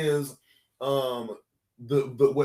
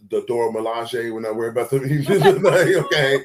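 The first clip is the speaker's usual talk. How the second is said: laughing; 8 dB louder than usual. Real, not acted. Laughing mid-rant.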